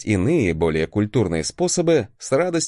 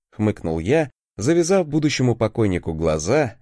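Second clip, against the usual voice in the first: usual speaking voice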